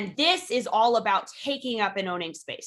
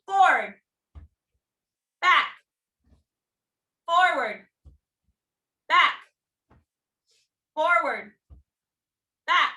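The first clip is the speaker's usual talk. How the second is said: high, punchy one-word commands with long pauses; raised-pitch instructor counts; calling drill commands; 6 semitones higher, 4 dB louder, slower than usual. Real, not acted. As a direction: call out the steps to a class.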